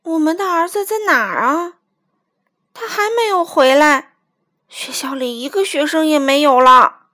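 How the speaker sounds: anxious acted worrying; worried. A narrator's reading. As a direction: fret loudly over a missing child. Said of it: "老鼠妈妈正在担心".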